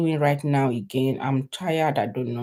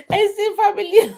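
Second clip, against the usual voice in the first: laughing; 14 semitones higher, 7 dB louder than usual. Real, not acted. Laughing at own Pidgin attempt.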